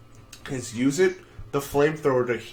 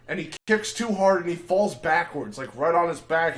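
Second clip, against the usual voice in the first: ordinary delivery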